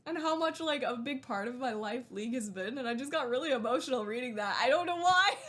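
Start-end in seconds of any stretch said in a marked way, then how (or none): 4.6-5.3 high, loud, tearful laugh-talk; tears breaking in with a laugh; laughing through tears. Real, not acted. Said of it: "I'm getting emotional".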